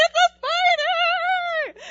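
high, slow, stretched panicked cry; frightened high wail. Retold or imitated. Shouting about the spider.

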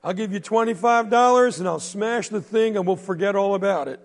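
mocking bully. Bully heckling the boy walking to school, loud jeering. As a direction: heckle the kid from the store porch.

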